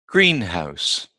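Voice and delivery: greenhouse with GREEN stressed; stress on first part. Shown as a demonstration. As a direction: hit GREEN in greenhouse, let house drop. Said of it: "generally the first part".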